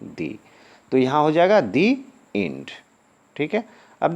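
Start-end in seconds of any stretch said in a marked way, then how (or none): 0.0-0.4 "दी" with a long ee, like "thee". Shown as a demonstration. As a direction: stretch the vowel in "दी". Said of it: "उच्चारण क्या कर देंगे हम". ("दी" लंबी ई के साथ)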